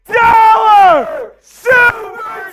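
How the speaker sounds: high, drawn-out yell of delight; excited shout. Real, not acted. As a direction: stretch it out screaming with joy. Reacting to big super chat.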